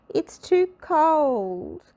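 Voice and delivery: a grouch whining about the cold; grumpy complaint. A narrator's reading. Mister Grumpy complains in the cold pyramid, drawn-out moan. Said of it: "complained Mister Grumpy".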